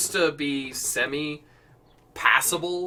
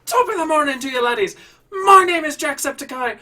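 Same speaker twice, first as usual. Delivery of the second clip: loud, high-pitched Irish intro shout; Jacksepticeye; 12 semitones higher, 10 dB louder, faster than usual. Retold or imitated. Mimicking a YouTuber's high-energy intro, loud hyper Irish impression. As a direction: burst out with manic, over-the-top Irish energy. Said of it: "His fucking intro. That man had so much excess energy".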